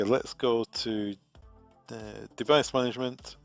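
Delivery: normal talking voice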